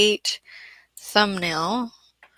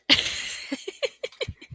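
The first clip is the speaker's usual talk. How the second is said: high, airy burst of laughter; real giggle, mostly breath; laughing. Real, not acted. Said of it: "I'm sorry, I'm laughing".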